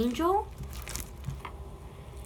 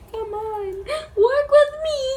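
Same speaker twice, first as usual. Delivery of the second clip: whiny plea; 12 semitones higher, 4 dB louder than usual. Real, not acted. Slime not cooperating.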